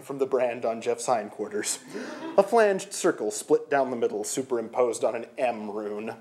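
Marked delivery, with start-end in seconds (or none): none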